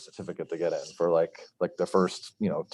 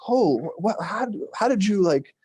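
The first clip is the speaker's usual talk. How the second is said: high, stammering astonished questions; amazed friends; 9 semitones higher, 3 dB louder than usual. Retold or imitated. Friends ask how he knew, high and astonished. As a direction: pepper him with amazed questions.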